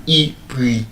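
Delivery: pre said as PREE, after a lone ee; long ee sound in pre. Shown as a demonstration. Teacher showing how the verb's vowel changes, long ee. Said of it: "The sound changed from e to e".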